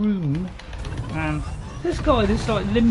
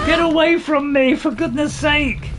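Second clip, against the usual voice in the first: loud, raised-pitch outburst; exasperated shout; 5 semitones higher, 4 dB louder than usual. Real, not acted. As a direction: yell at an enemy crowding you. Player hounded by game enemy.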